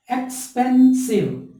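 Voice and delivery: "Expensive" slow and high, एक्स-पेन-सिव; split into syllables. Shown as a demonstration. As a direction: stretch out each syllable of "expensive". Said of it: "इस तरह".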